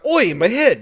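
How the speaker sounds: high, loud comic yelp; jokey exclamation. Acted. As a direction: play the bump for laughs. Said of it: "you know, like, a jokingly".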